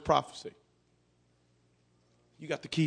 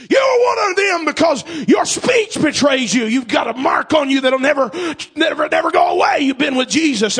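loud, higher accusing cry; accusing shout; 9 semitones higher, 14 dB louder than usual. Acted. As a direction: hurl it at him like a charge. Woman accuses Peter loudly.

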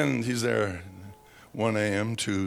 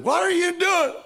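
high, loud startled question; shocked at finding legs in fireplace; startled boss; 6 dB louder than usual. Retold or imitated. Park boss discovering Eddie, loud and high. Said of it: "and he said".

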